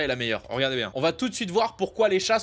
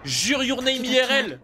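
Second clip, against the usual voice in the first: slow, louder amazed exclamation; 6 semitones higher, 4 dB louder, slower than usual. Real, not acted. Vlogger amazed at a real-life anime scene, louder. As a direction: marvel out loud.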